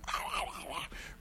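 quiet unvoiced chuckle; a soft airy laugh; breathy laugh. Real, not acted. Preacher chuckling at towering over colonel, airless laugh.

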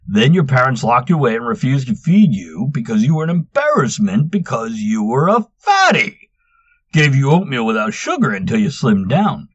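loud, high, taunting; mocking tease. A narrator's reading. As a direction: rub it in. Gunther teasing Manx.

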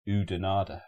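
Oudenaarde with an "oo" at the start; English reading. Shown as a demonstration. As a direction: read Oudenaarde as Oo-denarda, English-style. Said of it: "that just looks like".

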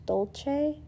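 "dolce?" said as DOL-chay with a questioning rise; unsure pronunciation. Shown as a demonstration. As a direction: try "dolce" the Italian way, unsure. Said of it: "I don't know how to pronounce it".

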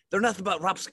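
high-pitched mock monologue snippet; spitting speechmaker. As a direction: mimic yourself spitting through a speech. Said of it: "like, spitting".

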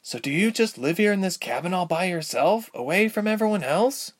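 put-on high girlish questioning voice; lifted, inquisitive girl; curious young Ilse. A narrator's reading.

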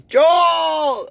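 high, loud drawn-out holler for Joel. Retold or imitated. Imitating a patient hollering for Joel, high loud holler. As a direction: call the name across the ward at full voice. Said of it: "she goes".

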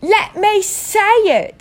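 high, loud, slow demand. Acted. Spoilt son demands the letter, high and insistent. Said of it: "demanded Dudley".

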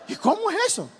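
pregunta aguda e insistente del muchacho; muchacho curioso. Retold or imitated. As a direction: mucho más agudo, como un joven ansioso. Muchacho pregunta otra vez. (muchacho insistente, con voz aguda)

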